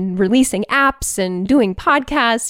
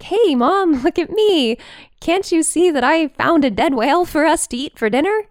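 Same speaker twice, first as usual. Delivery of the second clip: a light, higher proud puppy voice; proud dog voice; 7 semitones higher than usual. Pretend. Dog bragging to her owner about whale guts, lightly voiced and proud. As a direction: show off like a pleased pup.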